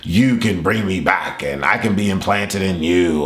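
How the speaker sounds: slow, low villain voice; Jigen. Retold or imitated. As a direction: drag it out, low and ominous. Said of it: "And Jigen is like".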